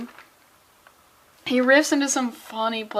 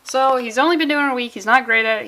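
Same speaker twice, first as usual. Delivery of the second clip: ordinary delivery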